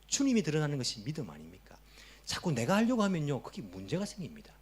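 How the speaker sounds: ordinary talk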